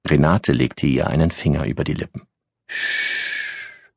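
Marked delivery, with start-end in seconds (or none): 2.7-3.9 a gentle unvoiced shh; quiet shh; soft shushing. A narrator's reading. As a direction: hush her softly with a finger on her lips. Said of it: "machte sie".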